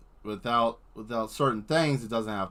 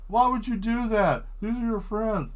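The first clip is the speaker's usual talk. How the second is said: higher scolding voice; mock objecting viewer; 12 semitones higher, 4 dB louder than usual. Imagined viewer scolding the streamer, higher scolding tone.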